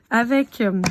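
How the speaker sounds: usual speaking voice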